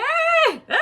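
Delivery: high, loud delighted squeals; giddy squeals. Pretend. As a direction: squeal with glee. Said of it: "I wanted to sit here and be like".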